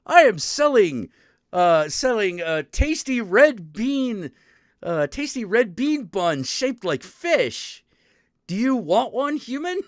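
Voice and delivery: cat shopkeeper voice. Retold or imitated. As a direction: play the cat spirit inviting a human to buy. Cat vendor offers fish bun.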